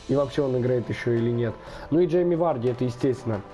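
ordinary delivery

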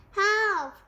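high, loud yelp; cry for help. A narrator's reading. Rat fleeing the hungry cat, alarmed cry. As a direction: yelp out for rescue.

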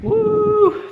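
high loud cheer; excited whoop. Real, not acted. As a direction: let out a happy whoop.

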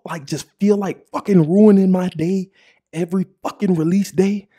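usual speaking voice

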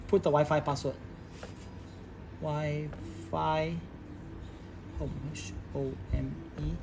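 normal talking voice

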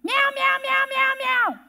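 high, relentless imitated meows; be a little cat with a big mouth; mouthy meowing cat. Little gray cat demanding food from owner, high imitated meowing. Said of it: "does she have a mouth".

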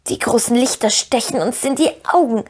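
rasping creature whimper; whining Gollum. A narrator's reading. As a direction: whine it out as a pitiful Gollum. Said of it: "jammerte Gollum".

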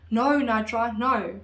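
loud retold cry, not a full scream. Daughter overhears her dad protesting to his wife, retold scream. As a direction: call it out loudly, imitating a dad's scream. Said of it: "she could hear her dad screaming".

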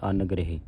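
ਅਨਗ੍ਰਹਿ quickly as "an-grehi"; misreading demonstrated. Shown as a demonstration. Teacher quotes what some congregations read, ਅਨਗ੍ਰਹਿ as "angrehi". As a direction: drop the u in ਅਨਗ੍ਰਹਿ and end on "hi". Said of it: "ਅਨਗ੍ਰਹਿ ਨਹੀਂ ਪੜ੍ਹਨਾ".

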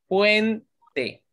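"Puente" with ue together, pause, te; syllable by syllable. Shown as a demonstration. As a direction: split "Puente" into two beats. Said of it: "U y la E están juntas".

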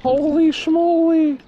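high, slow amazed exclamation; stunned wonder; surprised. Real, not acted. Spotting something surprising.

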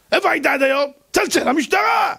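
the rabbi retorting at the panicked man; mock yell. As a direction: bark it back at him. Rabbi scolding the visitor.

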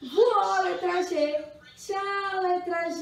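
high, excited mock send-off; playful farewell. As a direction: call a big playful goodbye to children's make-believe. Playfully waves the flying letter goodbye. (despedida animada de brincadeira)

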